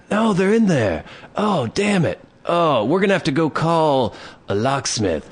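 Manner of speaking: hammed-up dazed drunk. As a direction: drag it out like a drunk faking panic. Drunk guy insisting.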